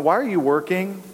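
normal talking voice

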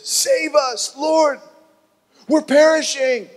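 high, loud panicked yell in his face; desperate shout for rescue. Acted. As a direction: scream it at the sleepy Lord. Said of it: "the guy yells in his face".

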